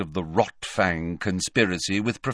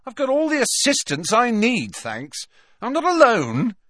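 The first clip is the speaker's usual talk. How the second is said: higher, louder proud retort; a boy bragging to the teacher; defiant Malfoy; 14 semitones higher, 4 dB louder than usual. A narrator's reading. Malfoy refusing Snape's protection, high and defiant.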